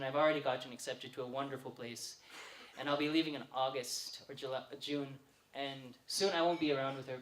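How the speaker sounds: soft, low, sincere tribute; heartfelt. Real, not acted. Brother leaving for university praising his sister, soft and heartfelt. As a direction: drop the comic energy and speak gently from the heart.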